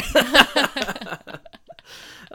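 loud high burst of laughter; just crack up; big laugh. Real, not acted. Speaker cracks up at own joke.

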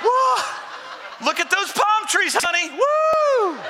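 high, gushing tourist gawking at palm trees; a sightseer cooing at the scenery while driving slow; dawdling tourist. Pretend.

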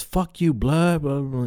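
pitched high, tossed-off insult; mock gang taunt. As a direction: imitate a rival calling him out. Retold gang members trading insults.

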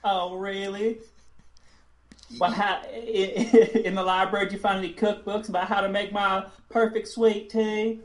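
high, laughing Southern belle; Southern lady voice. Acted. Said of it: "role play Carolyn".

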